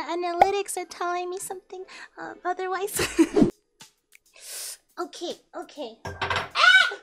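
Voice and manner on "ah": sudden high yelp; a quick startled cry. Real, not acted. Startled cry while cooking.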